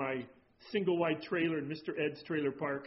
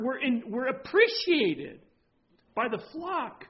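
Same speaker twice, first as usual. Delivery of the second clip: louder, fervent sermon delivery; preaching; 6 semitones higher, 5 dB louder than usual. Acted. Pastor exhorting the congregation, fervent preaching. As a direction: press each phrase home to the flock.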